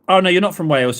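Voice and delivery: ordinary delivery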